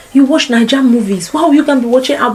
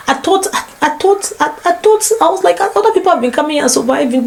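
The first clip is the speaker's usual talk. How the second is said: flustered disbelief; 7 semitones higher than usual. Real, not acted. Stammering disbelief at the accent.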